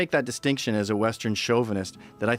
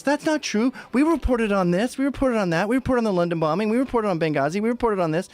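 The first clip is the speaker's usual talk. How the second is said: higher, indignant listing; mock media protest; 6 semitones higher than usual. A mock, higher protest voiced for the media. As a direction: whine each denial up high. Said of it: "the media goes".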